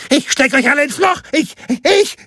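fast, very high squawk; frantic, high-pitched official's threat; screeching threat. A narrator's reading. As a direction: splutter with helpless rage. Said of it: "keifte dieser".

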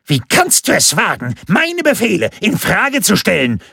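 high, outraged official; indignant squawk at being challenged; shrill scolding. A narrator's reading. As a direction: rant in an offended, high voice. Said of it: "zeterte der Dürre".

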